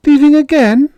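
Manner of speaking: high, loud cry of disbelief; a boy hurt by the news; dismayed child. Acted.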